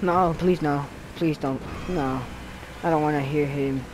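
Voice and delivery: ordinary talk, nothing marked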